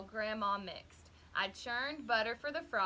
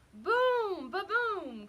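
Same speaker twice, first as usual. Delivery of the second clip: mock thunder crash, high and loud; booming thunder; 10 semitones higher, 6 dB louder, much slower than usual. A narrator's reading. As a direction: shout the boom like thunder. Thunder sound in story.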